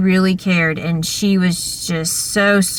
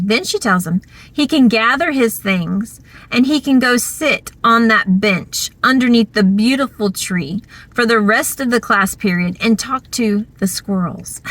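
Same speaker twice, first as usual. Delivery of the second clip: ordinary talk, nothing marked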